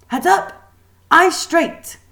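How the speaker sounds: high, loud barked commands; drill-sergeant teacher calling the line; teacher's order. A narrator's reading. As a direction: snap it out like a strict teacher. Teacher barking posture orders.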